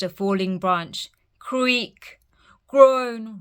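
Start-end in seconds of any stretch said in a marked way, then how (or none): 1.4-2.2 creaking sound whispered as a spooky effect; hushed spooky creak. A narrator's reading. Storm sounds in the wood. 2.7-3.4 spooky groan. A narrator's reading. Storm sounds in the wood.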